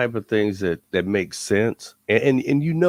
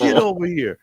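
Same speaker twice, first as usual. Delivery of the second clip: a laughing call, louder and higher; calling back; 8 semitones higher, 4 dB louder than usual. Retold or imitated. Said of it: "Carl was like".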